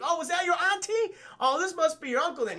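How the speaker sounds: high-pitched teasing imitation; mock taunting. Will Smith taunting the giant cockroach, mock taunting.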